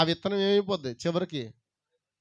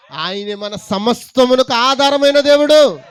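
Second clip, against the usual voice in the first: slow, loud, higher, every word weighed; solemn preaching declaration; 6 semitones higher, 12 dB louder than usual. Acted. Preacher declaring God to the church, slow and loud. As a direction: proclaim it as the foundation.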